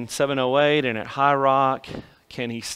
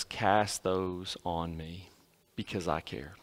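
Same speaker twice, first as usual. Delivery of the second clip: much softer and slow, lower, as Jesus comforting; tender invitation; 5 semitones lower, 9 dB quieter than usual. Acted. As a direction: lay it down gently, a soft slow promise. Jesus gently invites casting burdens.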